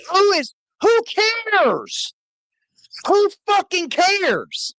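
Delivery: high scornful rant. Real, not acted. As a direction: sneer it, high and dismissive. Ranting at guest.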